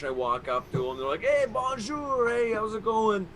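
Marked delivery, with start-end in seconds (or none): 1.2-3.3 put-on high, drawn-out Québécois accent; French Canadian greeting. Festival guys greeting him, high French Canadian accent. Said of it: "they barely speak English".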